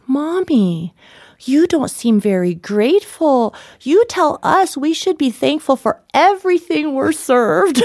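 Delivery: lightly voiced five-year-old scolding; child's voice. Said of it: "who's five".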